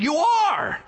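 high, loud "You are" cutting off doubt; forceful high cry of reassurance; emphatic shout. Acted. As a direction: slam the answer home.

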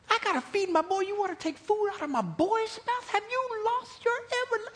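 very high-pitched, squealing indignation; hammed-up outrage, voice pushed way up; mock-appalled mother defending her boy; outraged widow. Widow's silent outrage, acted out.

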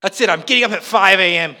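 very loud, hammed-up resolution; mock determined vow. Preacher playing early riser wannabe, loud mock resolve. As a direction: announce it with overblown resolve.

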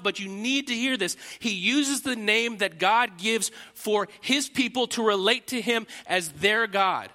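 normal talking voice

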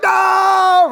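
high loud cry of protest; outraged no. Retold or imitated. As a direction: shout it like an appalled crowd. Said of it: "They're like".

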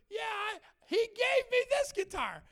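put-on high shouted kid's voice; boy's loud protest. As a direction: yell it like an upset child. Boy protesting loudly.

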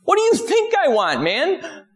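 very high, disbelieving squeak; incredulous. Retold or imitated. Beggar incredulous at Jesus' question, very high disbelieving. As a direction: act like the answer is obvious.

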